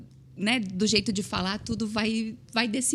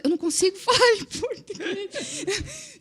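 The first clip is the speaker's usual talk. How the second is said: very high, dissolving into laughter; squealed through laughter; helpless laughing plea; 11 semitones higher than usual. Retold or imitated. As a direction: act out a nervous plea while cracking up. Retells nervous plea, breaks into laughter.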